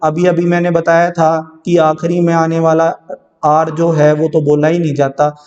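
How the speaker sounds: usual speaking voice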